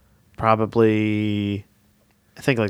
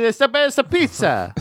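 higher, slow hammed-up Italian; mock Italian gesture voice; 10 semitones higher, slower than usual. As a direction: act out a pizza boast Italian-style. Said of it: "I say".

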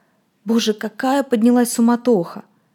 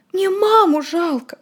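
much higher, slow, tearful; crying; 10 semitones higher, slower than usual. Acted. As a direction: sob out the words. Frightened boy crying.

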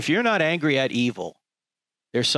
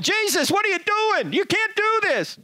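high, far-off scandalised bystander; protesting crowd at the tables; mock protester. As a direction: squeal like shocked onlookers. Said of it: "Everyone else is going".

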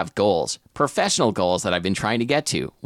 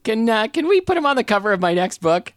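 lightly voiced, sweetly asking author, higher at the end; mock author voice; 9 semitones higher than usual. Host mocks the author asking her editor for a cover, lightly voiced pleading. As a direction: play a giddy author begging her editor.

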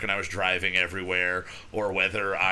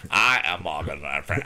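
Morgan Freeman impression; 5 semitones lower than usual. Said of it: "So then I just kept going".

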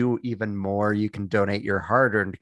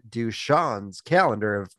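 ordinary delivery

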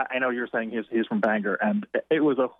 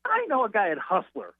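high, comic imitation dropping toward the end; Gilbert Gottfried; 7 semitones higher than usual. Comedian offering the writer a magazine contact, rough imitation. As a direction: pitch it up as a hammy comedian. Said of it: "I will do the worst imitation".

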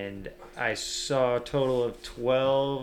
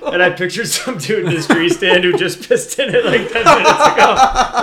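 loud and laughing, louder as it goes; 7 semitones higher, 14 dB louder than usual. Real, not acted. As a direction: crack up while telling it. Funny story told laughing.

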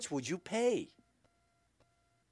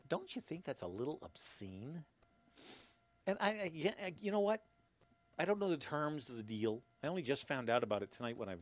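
usual speaking voice

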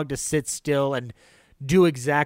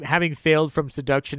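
ordinary talk, nothing marked

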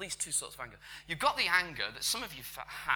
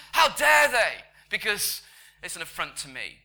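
explosive, high, loud indignation; outraged anger; 6 semitones higher, 15 dB louder than usual. Acted. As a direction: burst out in affronted fury, then ease down. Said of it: "There's an anger that goes".

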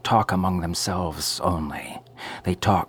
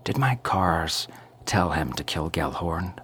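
ordinary delivery

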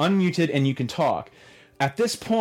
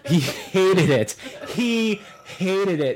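laughing; 4 semitones higher, much slower than usual. Real, not acted. Laughing retelling the host.

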